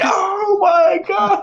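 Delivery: long drawn-out, very high squeal; hammed-up helpless hilarity; hysterical mock shriek. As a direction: shriek it as if overcome with laughter. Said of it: "I just can't stop laughing".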